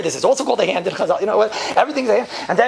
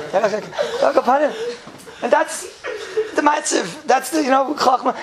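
laughing; 7 semitones higher, slower than usual. Real, not acted. Teacher cracks up at own joke.

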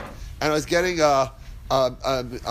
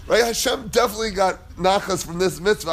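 ordinary talk

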